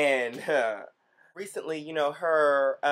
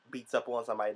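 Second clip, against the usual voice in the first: ordinary talk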